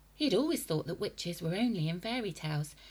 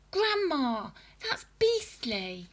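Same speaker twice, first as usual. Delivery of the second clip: put-on disgusted boy; 8 semitones higher, 6 dB louder, slower than usual. A narrator's reading.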